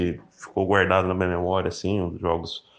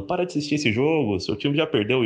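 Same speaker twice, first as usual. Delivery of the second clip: higher-pitched scolding mom impression; scolding mother; 8 semitones higher than usual. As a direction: lightly voice an exasperated mother. Mãe mandando parar o jogo. (imitando a mãe dando bronca)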